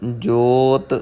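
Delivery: slow, drawn-out scripture reading with long line endings; Gurbani recitation. Shown as a demonstration. Teacher models the verse for learners, slow measured recitation. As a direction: recite it slowly, holding the final vowels.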